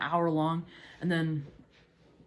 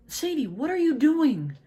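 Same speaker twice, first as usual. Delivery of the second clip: calling her sister's name, pitched up and slow, a little louder; 7 semitones higher, 4 dB louder than usual. Retold or imitated. A sister calling for her missing little sister, raised and slow. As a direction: call out across the street, slowly, to find her. Searching neighborhood for runaway sister.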